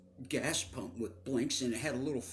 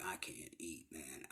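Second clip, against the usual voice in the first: hushed, breathless whisper, barely voiced; whispered refusal; 9 semitones lower, 15 dB quieter than usual. To his mom in the car, overwhelmed, a whispered refusal. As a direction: whisper it like you're too shaken to eat. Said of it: "I'm like".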